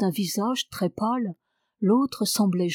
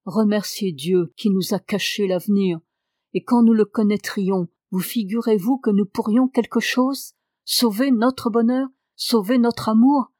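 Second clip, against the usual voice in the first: rising, louder vehement outburst; muted vehemence; 4 dB louder than usual. Acted. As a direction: build from muffled force to a loud cry. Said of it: "avec une sourde véhémence". (véhémence sourde qui éclate plus fort)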